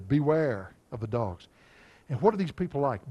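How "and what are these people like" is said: ordinary talk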